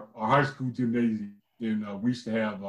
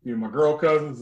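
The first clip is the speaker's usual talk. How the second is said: ordinary delivery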